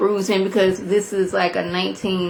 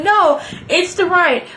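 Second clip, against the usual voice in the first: panicked correction; 9 semitones higher, slower than usual. Retold or imitated. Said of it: "Then I was like".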